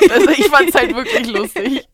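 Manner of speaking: loud laugh through the words. Real, not acted. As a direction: burst out laughing as you say it. Lacht über die eigene Geschichte.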